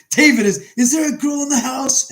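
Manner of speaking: high, loud shouted question; imitated yell from another floor; yelling upstairs. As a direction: holler it through the house. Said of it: "He's yelling, he goes".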